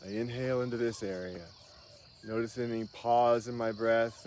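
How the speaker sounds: wellness guru to viewers, calmly leading a breathing meditation; guided breathing meditation. Acted. Leads breathing exercise on camera.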